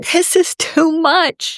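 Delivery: mock nervous system cracking; overwhelmed inner voice. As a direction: let it drag out like you're at your limit. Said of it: "your nervous system wants to go".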